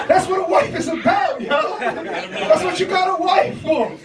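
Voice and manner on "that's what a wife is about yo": ordinary talk, nothing marked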